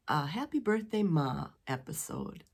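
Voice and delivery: normal talking voice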